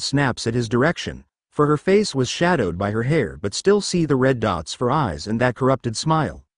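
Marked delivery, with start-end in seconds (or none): none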